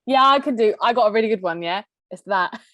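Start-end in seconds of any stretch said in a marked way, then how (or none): none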